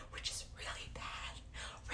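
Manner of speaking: breathy whispered aside, voice dropped away. Real, not acted. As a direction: whisper it to yourself, embarrassed. Laments low stream hours.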